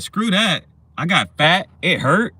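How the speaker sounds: playfully acted protest; mock indignant refusal. Acts out her refusing another kid.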